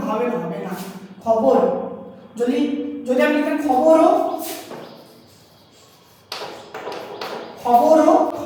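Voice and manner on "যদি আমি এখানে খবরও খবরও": খবরও said with a rising, drawn final ro; trailing o sound. Shown as a demonstration. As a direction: let খবরও end on a pulled ro. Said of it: "কারণ রো বলছি". (খবরও-র শেষে রো টেনে)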